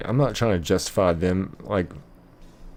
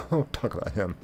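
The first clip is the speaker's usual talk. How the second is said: "Don't talk about him" said through a soft laugh, much quieter; 7 dB quieter than usual. Real, not acted. Streamer repeating a warning to chat, laughing softly. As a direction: chuckle the words out softly.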